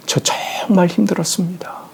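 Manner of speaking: breathless whisper, worn out, on 정말; a son whispering to his late father, exhausted; whispered monologue. Retold or imitated. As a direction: confess 저 정말 힘들었습니다 in a breaking whisper, stress on 정말. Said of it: "독백하시지".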